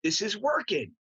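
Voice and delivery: rising to a high, pleased cheer; self-congratulating mock cheer; delighted realization. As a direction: act out happy amazement, pitch climbing. Acting out delighted self-discovery.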